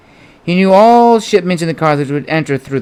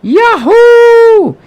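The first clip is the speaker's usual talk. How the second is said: huge, high battle yell into the air; war cry held long and high; loud yell; 8 dB louder than usual. Acted. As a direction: yell to stun the crowd. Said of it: "Amynas let out a loud yell".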